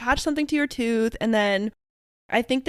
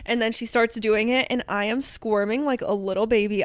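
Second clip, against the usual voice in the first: usual speaking voice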